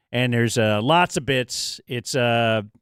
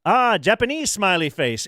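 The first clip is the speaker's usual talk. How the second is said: higher, mock Japanese accent as the chef; hammed-up chef showman line; imitating the chef; 6 semitones higher than usual. Imitating the hibachi chef to friends, higher mock accent. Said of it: "he goes".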